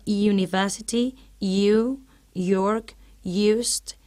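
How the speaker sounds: "university, you, York, used" each opened with a y glide; spaced model words "university, you, York, used", starting on a y sound; initial Y sound. Shown as a demonstration. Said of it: "Así encontramos el sonido".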